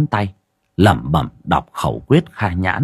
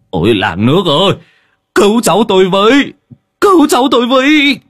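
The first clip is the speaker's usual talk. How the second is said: frantic shout climbing much higher and louder; desperate cry for help; 11 semitones higher, 6 dB louder than usual. Acted. Old grandfather screams to villagers to save his grandson, panicked shout. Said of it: "miệng kêu lên".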